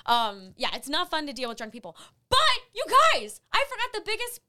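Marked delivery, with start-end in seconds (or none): 2.3-4.4 high, loud burst of excitement; excited announcement. Real, not acted. Host suddenly remembering her best story, excited announcement. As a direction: grab everyone's attention with big news.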